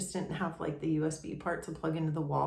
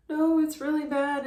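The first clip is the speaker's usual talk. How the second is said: breaking down; 9 semitones higher, 4 dB louder than usual. Retold or imitated. Man breaks down, admits hardship.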